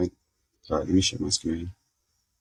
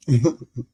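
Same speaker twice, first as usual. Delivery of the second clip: short laugh; 5 semitones higher, 5 dB louder than usual. Real, not acted. Laughing at own toothache.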